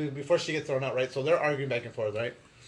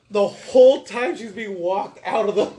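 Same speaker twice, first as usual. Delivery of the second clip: ordinary delivery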